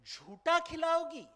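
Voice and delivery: indignant, horrified outburst; horrified recoil. Acted. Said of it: "recoils in horror and says".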